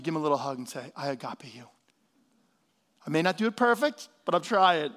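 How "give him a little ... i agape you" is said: soft, low, gentle affection; hushed, low loving words; tender hush. Shown as a demonstration. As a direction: say it softly, as if into a hug. Modelling tender words for a child.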